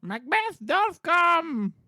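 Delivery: high, slow theatrical witch call; witch's cry. Acted. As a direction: draw it out high, announcing an arrival. Acting witch's line from play.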